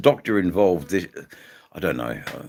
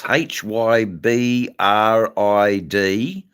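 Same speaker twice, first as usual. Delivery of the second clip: letter by letter, slow and loud; slow spelled-out letters; 4 semitones higher, 5 dB louder, much slower than usual. Shown as a demonstration. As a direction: spell each letter out clearly. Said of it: "spelling out the strain name".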